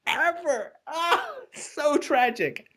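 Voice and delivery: high, slow hammed-up grief with a laugh; mock tragic lament. Lamenting the poem's lost ending, hammed-up grief. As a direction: ham up the tragedy.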